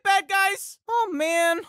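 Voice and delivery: ordinary talk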